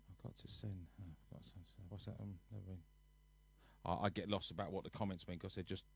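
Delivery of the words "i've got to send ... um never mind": very soft, low mumble; muttering to self. Real, not acted. Streamer puzzling over viewers' comments to himself, muttered low. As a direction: drop right down, as if thinking aloud.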